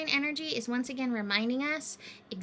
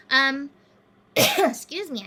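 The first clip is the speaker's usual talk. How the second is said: cough, then a hoarse excuse me; coughing on dust; 2 semitones higher, 9 dB louder, slower than usual. Real, not acted. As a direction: clear the dust from your throat. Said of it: "I inhaled something weird".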